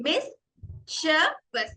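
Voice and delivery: "mischievous" very slowly as MIS, CHUH, VUS with pauses; "mischievous" syllable by syllable, short "chuh"; split into syllables. Shown as a demonstration. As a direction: break "mischievous" into three separate beats. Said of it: "Split the word into three".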